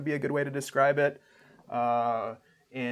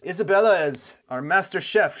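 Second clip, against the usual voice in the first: louder, higher host voice; proud host; 6 semitones higher, 6 dB louder than usual. Acted. Game master as camp host introducing the chef, louder and higher. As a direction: present the cook with voice raised. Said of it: "And he says".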